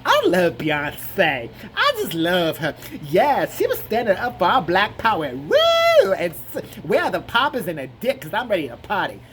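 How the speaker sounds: loud, gushing camp voice with a whoop; hammed-up excited camp caricature; flamboyant man. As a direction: swoon and cheer theatrically. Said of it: "the queen next to her is like".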